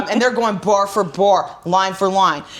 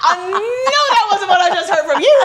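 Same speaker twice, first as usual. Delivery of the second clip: higher, laughing mock threat; mock tough; 9 semitones higher than usual. Paul Walker sassing The Rock, laughing. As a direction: sneer it while laughing. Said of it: "he's like".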